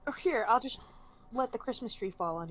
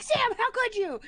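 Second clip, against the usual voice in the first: high mock-angry scolding; hammed-up shriek at her partner; wife berating Sam, high mock fury; mock outrage; 4 dB louder than usual. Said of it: "I'm like".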